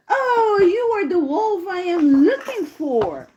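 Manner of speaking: high-pitched storybook hunter's cry; exclaiming hunter. Acted. Hunter finds the snoring wolf, high exclamation. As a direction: call out as a hunter spotting the prey.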